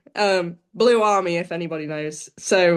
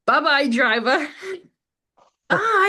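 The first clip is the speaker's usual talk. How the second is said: mock Scottish; 7 semitones higher, 3 dB louder than usual. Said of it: "better accent for it".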